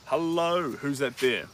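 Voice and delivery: loud greeting. Real, not acted. Welcoming a new viewer into the live stream, loud and bright. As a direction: call out a delighted welcome.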